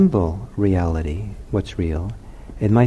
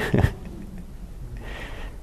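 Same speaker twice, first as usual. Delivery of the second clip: quiet laugh; 3 semitones higher, 10 dB quieter than usual. Real, not acted. Laughing at own sleepless night.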